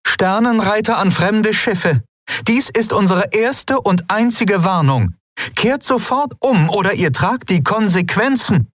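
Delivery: Aylan issues a last warning to enemy ships; stern warning. Acted. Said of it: "Aylan blickte streng".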